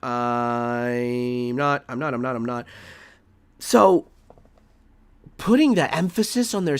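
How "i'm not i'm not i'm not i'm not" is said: stubborn refusal. Real, not acted. Streamer refuses to accept the claim.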